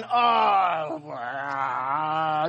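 strained oh then low wow, like a sudden cramp; hammed-up agony, strained then dropping; mock cramp groan. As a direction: play up a pained oh wow. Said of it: "And I'm sitting there going".

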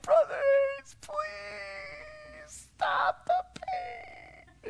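high, dragged-out crying plea; mock wailing children. Punished children begging their teacher, hammed-up wail. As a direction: whine it like kids with aching arms.

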